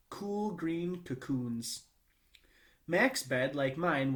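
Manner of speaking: ordinary delivery, nothing marked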